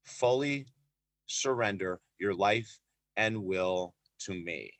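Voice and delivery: very slow, low and measured; hushed words from heaven, spaced out; solemn divine message. Retold or imitated. God to a praying man on the track, slow and solemn.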